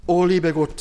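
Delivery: loud acted cry to God; desperate shout. Trapped man screams to God before the train hits, desperate. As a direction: shout it out as a last plea. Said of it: "schrie er wei-, verzweifelt".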